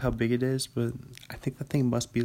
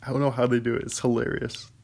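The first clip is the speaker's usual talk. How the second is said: ordinary delivery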